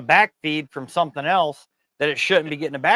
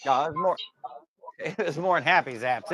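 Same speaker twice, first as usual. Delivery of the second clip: usual speaking voice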